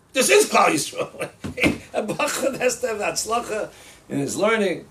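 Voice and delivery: loud, high retold outburst; emphatic proclamation. Rabbi insists on the student's importance, emphatic proclamation. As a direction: proclaim it forcefully, hammering each word.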